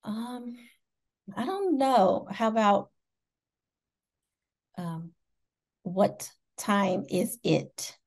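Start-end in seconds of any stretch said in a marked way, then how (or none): 5.8-8.0 slow, careful American model; American accent demo. Shown as a demonstration. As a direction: teach it slowly in an American accent. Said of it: "What can you teach me?".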